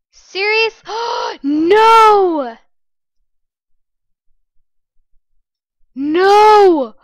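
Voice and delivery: loud, high cry of no; distressed shout. Real, not acted. Player trapped in a pit, distressed loud cry. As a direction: yell in despair as you're trapped. Caught in trap.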